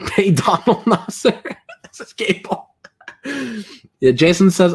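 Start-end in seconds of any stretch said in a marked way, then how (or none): 0.0-2.7 laughing. Real, not acted. Giggling at chat chant.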